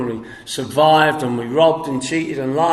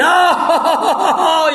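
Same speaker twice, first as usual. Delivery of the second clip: high, loud mocking cackle; imitated laughter; 12 semitones higher, 5 dB louder than usual. Sponsor laughing at his blackout, loud high cackle. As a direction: laugh up high at the poor drunk. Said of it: "he laughed".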